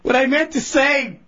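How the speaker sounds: losing composure into a laugh; barely holding in laughter; breaking up laughing. Real, not acted. Host cracking up during his own bit, breaking into laughter.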